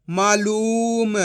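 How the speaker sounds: मालूम said very slowly with a long ऊ in लू; long drawn ऊ. Shown as a demonstration. Teacher models the long ऊ, drawn out. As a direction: stretch लू in मालूम. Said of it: "खींच के पढ़ा जाता है".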